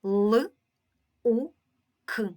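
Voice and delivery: each sound of Л, У, К said alone with pauses; isolated sounds, teacher modelling. Shown as a demonstration. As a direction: spell out Л, У, К as separate sounds. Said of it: "маємо таку звукову модель".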